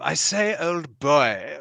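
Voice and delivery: put-on old-fashioned English gentleman accent; hammed-up English accent. Shown as a demonstration. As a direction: greet them like a British toff, pitch lifted at the start. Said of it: "was as English as the".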